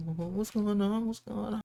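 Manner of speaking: high, womanly voice in mock alarm; startled wake-up in an exaggerated feminine pitch; Maddie. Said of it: "Maddie has this high ass, like, super womanly voice".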